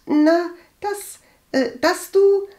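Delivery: high, halting hesitation; stammering. A narrator's reading. Cousin avoids repeating gossip to Agathe, stammering. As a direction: stumble over the start, breaking off. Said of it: "stotterte Walburga".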